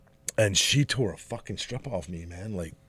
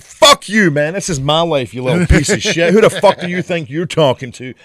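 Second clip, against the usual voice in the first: loud, high, hammed-up tirade; acted yelling, indignant and profane; furious outburst; 8 semitones higher, 12 dB louder than usual. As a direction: explode in mock outrage at someone. Acted furious comeback to his son.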